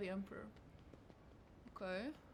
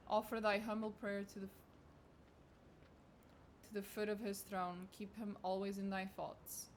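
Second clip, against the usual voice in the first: solemn sermon reading; 4 dB quieter than usual. Acted. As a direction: intone it like a priest's litany. Reads religious sermon text.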